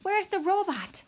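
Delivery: high rasp, rising in urgency; injured mother bear's raspy, high voice. A narrator's reading. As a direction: rasp through the pain, then demand. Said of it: "she rasped".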